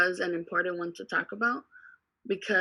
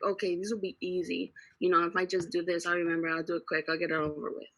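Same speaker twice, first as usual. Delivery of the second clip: soft, quick run-through of a thought; retold private thought; 2 semitones lower, 7 dB quieter, faster than usual. Student recalling easy topics to the teacher, quiet and hurried. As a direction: toss it off quietly and hurriedly, as if thinking aloud.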